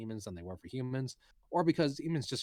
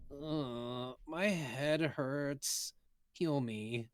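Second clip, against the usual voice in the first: put-on weak, slow pleading girl; sick Eris; 5 semitones higher, much slower than usual.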